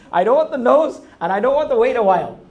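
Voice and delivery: hammed-up insistent complaint; mock petulant refusal. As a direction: refuse like a spoiled petitioner. Acted demanding prayer to God.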